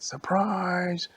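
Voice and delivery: party crowd's big shout; surprise shout. Acted. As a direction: jump out and yell it.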